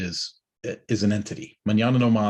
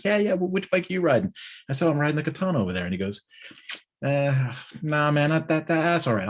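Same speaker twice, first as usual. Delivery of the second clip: higher, lightly voiced brush-off; dismissive rider; 6 semitones higher than usual. Ducati guy turning down a ride, lightly mocked and higher. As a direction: back out of the offer with a shrug in the voice.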